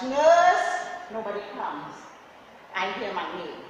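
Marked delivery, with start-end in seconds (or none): 0.0-0.7 loud call, higher. Retold or imitated. Patient alone on the floor calling the nurse, loud. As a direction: cry out for the nurse.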